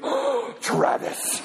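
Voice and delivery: whispered in surprise; breathless whisper. Retold or imitated. Girlfriend recognising her boyfriend, whispered in awe. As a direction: breathe his name out, stunned. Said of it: "she goes".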